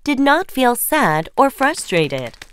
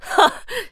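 mocking laugh. Sister laughing.

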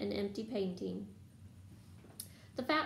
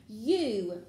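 louder burst of mild fury; furious; 7 dB louder than usual. A narrator's reading. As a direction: let anger land hard on the word. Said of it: "Ron said furiously".

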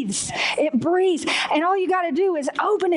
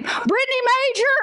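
higher, calling-out voice; imitated roll-call shout, pitched up; calling out a name; 8 semitones higher than usual. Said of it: "they call my name".

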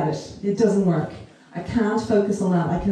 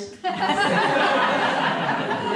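a light airy laugh, mostly breath; high breathy laugh; 10 semitones higher than usual. Real, not acted. Sharing a joke with the audience, airy laugh.

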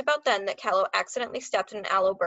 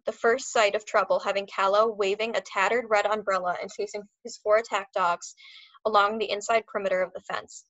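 ordinary delivery, nothing marked

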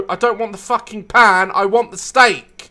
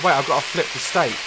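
ordinary talk

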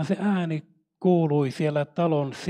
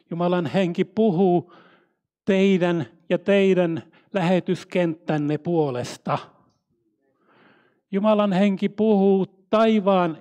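raised, emphatic sermon voice with weighty pauses; impassioned preaching; 3 semitones higher, 5 dB louder than usual. Real, not acted. Preacher tells missionaries the Spirit speaks for them, proclaiming. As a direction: proclaim it phrase by phrase.

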